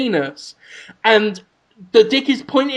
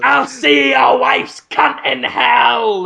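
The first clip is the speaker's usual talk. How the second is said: slow, high shouted curse; parting curse; 5 semitones higher, slower than usual. Acted. Baby cursing Brendan Fraser as it falls, shouting. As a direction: spit it out as you fall. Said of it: "That's what he says as he goes down".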